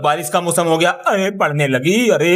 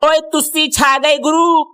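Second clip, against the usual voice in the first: excited Punjabi cheer; 11 semitones higher, 4 dB louder than usual. Acted. Teacher's delighted Punjabi praise.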